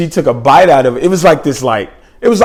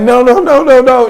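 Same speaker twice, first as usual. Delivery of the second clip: high, loud panicked refusal; freaking out; 11 semitones higher, 5 dB louder than usual. Retold or imitated. Girl who won't go to the room alone, freaking out. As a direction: imitate her frantic string of protests. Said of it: "She starts freaking out".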